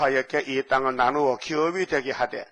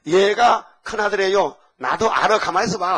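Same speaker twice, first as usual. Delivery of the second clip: insistent protest; 5 semitones higher, 6 dB louder than usual. Retold or imitated. Father and son arguing.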